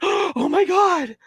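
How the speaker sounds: put-on a girl shocked at what she sees; stunned gasp. Stranger holding his phone, slow mock awe.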